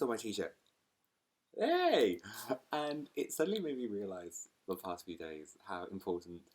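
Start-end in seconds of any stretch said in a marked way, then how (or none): 1.6-2.2 high, loud playful hey; excited greeting. Real, not acted. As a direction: greet it like a friend. Notices Jafar on t-shirt.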